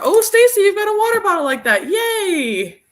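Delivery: telling Stacy she has the same bottle, high and excited; delighted squeal. Real, not acted. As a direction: gush over a matching water bottle. Delighted a viewer shares her bottle.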